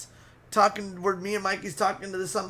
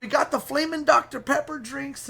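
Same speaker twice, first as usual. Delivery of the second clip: ordinary talk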